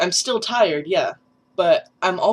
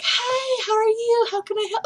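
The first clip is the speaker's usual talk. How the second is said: high, sing-song greeter pitch; customer service voice. Shown as a demonstration. Demoing the old work voice for viewers, high customer service voice. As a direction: put on a much higher customer service greeting. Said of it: "getting out of my customer service".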